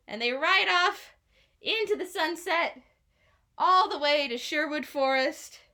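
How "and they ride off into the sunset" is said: plain reading voice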